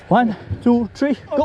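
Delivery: high straining count to lift; lifting together. Real, not acted. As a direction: call the count, rising to go.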